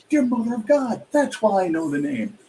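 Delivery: high-pitched mock amazement; astonished realization. Writer retelling placing a familiar name, in high mock astonishment. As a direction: jump up high as the penny drops. Acts out his own sudden realization.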